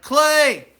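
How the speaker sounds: high, loud call for help; retold yell. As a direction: shout for the friend from the locked bathroom. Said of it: "And then I yell".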